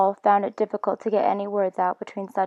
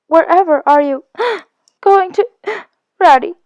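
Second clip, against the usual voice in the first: much higher and louder, gasping between words; tearful alarm; 10 semitones higher, 9 dB louder than usual. Acted. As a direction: cry out in alarm through tears. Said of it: "cried the tearful Mole, looking up in alarm".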